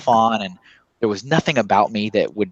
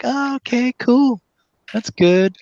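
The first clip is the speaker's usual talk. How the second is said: much higher, forced cheerful brightness; fake enthusiasm; slower than usual. Tired Lego worker to an eager fan, forced cheer. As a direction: pretend to be thrilled while exhausted. Feigning enthusiasm while exhausted.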